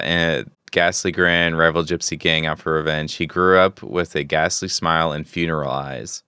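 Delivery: ordinary talk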